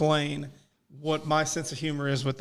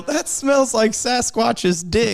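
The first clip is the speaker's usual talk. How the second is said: slow, drawn-out mock yell, a bit higher; mock off-screen yell; 5 semitones higher, 4 dB louder, much slower than usual. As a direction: stretch it out like a shout from off screen.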